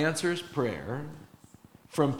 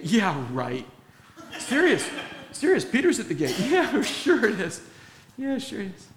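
higher, laughing scoff; mocking disbelief; 6 semitones higher than usual. Praying disciples laughing off Rhoda, higher and mocking. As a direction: brush her off with a laugh.

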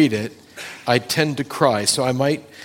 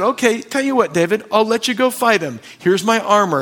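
Saul offering; 8 semitones higher, 5 dB louder than usual. Retold or imitated. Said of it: "Saul said".

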